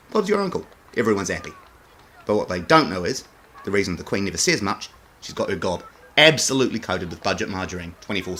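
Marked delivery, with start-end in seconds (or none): none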